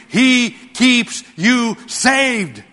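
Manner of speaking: "keeps" and "saved" stretched slowly, every word weighted; drawn-out emphasis. Real, not acted. Pastor driving the point home to his congregation, slow and weighty. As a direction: spell out "he keeps you saved" so nobody misses it. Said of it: "Get these words".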